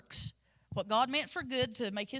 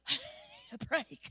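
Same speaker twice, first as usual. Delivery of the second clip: out of breath; 4 semitones higher, 4 dB quieter than usual. Retold or imitated. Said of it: "I'm huffing and puffing going".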